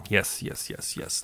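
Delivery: unvoiced, quiet yes yes; whispered musing. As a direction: whisper it to yourself, pleased. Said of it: "The hare thought".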